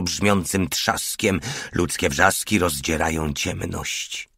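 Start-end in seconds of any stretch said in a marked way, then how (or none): none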